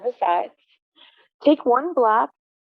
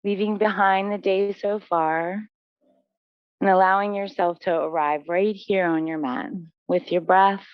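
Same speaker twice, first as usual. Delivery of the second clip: low, even meditation voice with long pauses; guided relaxation; 5 semitones lower than usual. Real, not acted. Yoga teacher guiding students into breath and stillness, calm guided relaxation. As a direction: settle the class into stillness, lower and unhurried.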